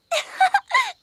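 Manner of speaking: éclat de rire aigu; rire d'enfant. Acted. Enfants rient du souvenir. (rire aigu et joyeux)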